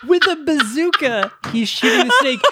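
high, incredulous, real laughter breaking in; laughing disbelief. Real, not acted. Co-host echoing the bazooka absurdity, laughing hard. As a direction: crack up while marvelling at it.

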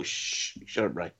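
low, quiet shush and brush-off; imagined heckler cutting him off quietly; dismissive shushing. Pretend. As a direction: hush him wearily under your breath. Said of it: "someone just to go".

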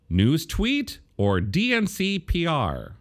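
NEWS TWEET and D N C P R both pitched high and drawn out; posed as question. Retold or imitated. Host rereading own quote tweet, posed as a slow question. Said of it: "I said it as a question".

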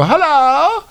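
high mock royal caller voice; 1 high put-on caller voice. Host puts on a high voice as a caller. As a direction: squeak out a silly aristocratic hello. Mock Prince Andrew call-in.

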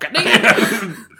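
a loud, laughing "God damn"; laughing exasperation. Retold or imitated. Husband laughing in exasperation at his wife's text reply. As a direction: groan it out with a laugh.

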